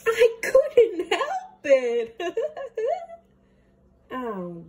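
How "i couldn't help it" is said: loud, slow, high wailing half-laugh; laughing cry. Acted. David wails excuse at dinner, loud and slow. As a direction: wail and laugh at once like a kid.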